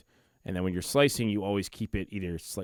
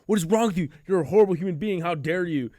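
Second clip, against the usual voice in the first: loud, high-pitched mock-horrified tirade; outraged scolding; 9 semitones higher, 7 dB louder than usual. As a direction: berate your sister with hammed-up outrage. Retold outburst at sister over sugar.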